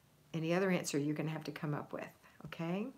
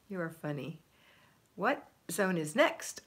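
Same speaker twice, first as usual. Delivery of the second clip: normal talking voice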